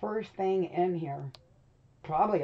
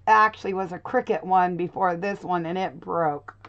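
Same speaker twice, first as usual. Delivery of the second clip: ordinary talk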